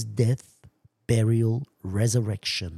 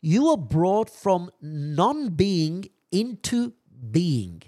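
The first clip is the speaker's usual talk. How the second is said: high, loud preaching read; fervent preaching; 9 semitones higher, 5 dB louder than usual. Real, not acted. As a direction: proclaim the quotation to the congregation. Preaches with building force.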